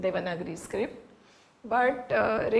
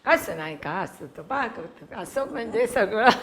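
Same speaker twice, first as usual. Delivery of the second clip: put-on Tamil accent; 6 dB louder, much faster than usual. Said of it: "in typical Tamil accent they would".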